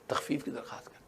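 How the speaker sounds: low, breathy whispered counsel; whispered advice. Acted. Moses whispering advice to the Prophet to seek reduction. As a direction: whisper it like a confidential tip.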